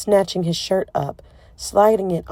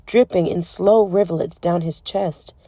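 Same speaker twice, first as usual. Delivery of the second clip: usual speaking voice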